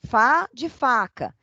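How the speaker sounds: slow, syllable by syllable; slow syllable drill. Shown as a demonstration. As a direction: spell out the syllables like a first-grade teacher. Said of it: "Lembram disso?". (soletrando devagar, sílaba por sílaba)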